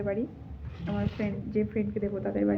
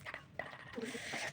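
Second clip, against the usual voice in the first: breathy whispered goodbye; whispering; 22 dB quieter, much slower than usual. Real, not acted. Signing off to viewers at night, whispered goodbye. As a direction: whisper the byes so nobody wakes. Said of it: "আস্তে আস্তে কথা বলছি".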